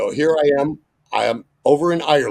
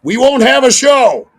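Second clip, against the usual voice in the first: mock warning; 13 semitones higher, 11 dB louder than usual. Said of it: "He says".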